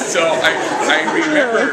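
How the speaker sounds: loud, high, still laughing while talking; words squeezed out through laughter. Real, not acted. Priest still laughing while telling the story. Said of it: "howling with laughter".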